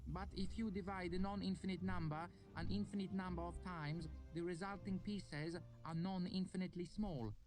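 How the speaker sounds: put-on high, careful Lanati accent; student Uresh explaining infinity in a Lanati accent; odd Lanati accent. A narrator's reading. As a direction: reason it out in a foreign Lanati lilt. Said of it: "in his odd Lanati accent".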